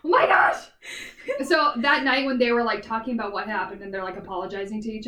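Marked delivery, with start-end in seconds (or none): 0.0-0.6 exasperated exclamation. Real, not acted. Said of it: "I didn't say any of this".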